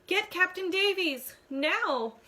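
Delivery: high, loud urgent call; urgent shout. A narrator's reading. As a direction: yell the order urgently. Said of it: "The shout is closer".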